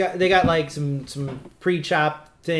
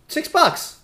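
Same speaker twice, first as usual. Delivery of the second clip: outraged at the price; high indignant squeak; offended and indignant; 12 semitones higher, 4 dB louder than usual. Real, not acted. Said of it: "that's insulting".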